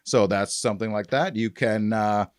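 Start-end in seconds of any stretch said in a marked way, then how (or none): none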